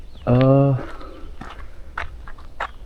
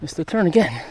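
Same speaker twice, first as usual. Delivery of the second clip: usual speaking voice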